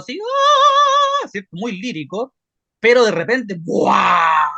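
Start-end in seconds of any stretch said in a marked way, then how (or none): 0.2-1.3 piercing high falsetto cry; falsetto wail. Shown as a demonstration. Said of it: "unos falsetes muy agudos". 3.6-4.4 loud raspy cavernous bark; raspy growl. Shown as a demonstration. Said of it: "Hace con una voz más rasposa".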